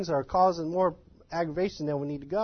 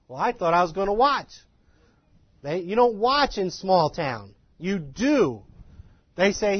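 ordinary delivery

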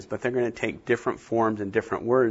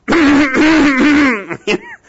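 imitated throat clearing; 11 dB louder than usual.

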